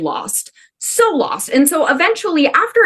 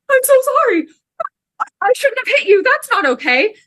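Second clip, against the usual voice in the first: much higher, small apologetic voice; sheepish apology; 13 semitones higher than usual. Retold or imitated. Apologizing to James after punching him, sheepish high voice. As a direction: squeak out a sorry after the punch.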